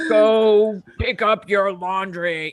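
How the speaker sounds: slow, loud commanding order. Retold or imitated. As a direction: bark it slow with authority. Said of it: "I would say it in a deeper voice".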